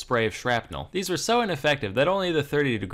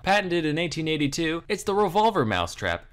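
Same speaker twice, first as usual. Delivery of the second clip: higher, bright infomercial pitch; old radio announcer; 5 semitones higher than usual. Acted. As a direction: sell it like a vintage radio ad. Product pitch for the mousetrap.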